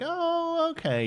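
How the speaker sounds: high drawn-out "oh" dropping back down; imitated vague recognition. Streamer imitating Canadians hearing an unknown town, high feigned recognition. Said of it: "they're like".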